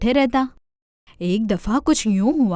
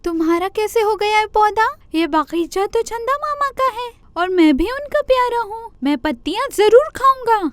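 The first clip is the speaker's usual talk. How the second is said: much higher, defiant deer voice; 10 semitones higher than usual. Acted. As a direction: stand your ground, offended little deer. Deer quarrels with rabbit.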